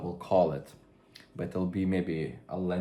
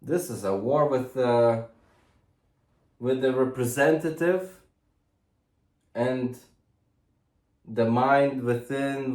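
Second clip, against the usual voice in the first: raised, emphatic military address; mock boot-camp yell; 4 semitones higher, 4 dB louder than usual. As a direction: lecture like a mock drill instructor. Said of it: "We're here doing military boot camp".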